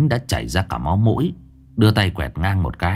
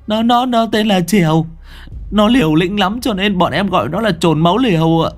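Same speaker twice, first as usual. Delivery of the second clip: high youth voice; 10 semitones higher than usual. A narrator's reading. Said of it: "Thằng Quang chỉ tay".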